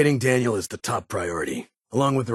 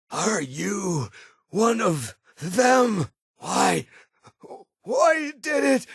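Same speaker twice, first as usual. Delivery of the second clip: much higher, frantic, voice breaking into tears; panicked pleading; 14 semitones higher, much slower than usual. Acted. Terrified figure begging for answers, then crying. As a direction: plead in terror, drawn out and slow.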